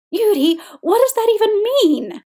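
high, loud exasperated demand. A narrator's reading. Fuming husband to his partner, exasperated. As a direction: demand an answer, pitch soaring.

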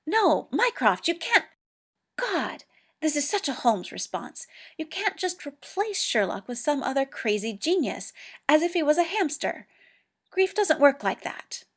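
grieving John raging at Mycroft; angry outburst. A narrator's reading. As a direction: rail at Mycroft in grief. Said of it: "practically a shout".

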